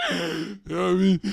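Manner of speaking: laughing through the words; real laughter, high and breathy; cracking up. Real, not acted. Breaks into laughter.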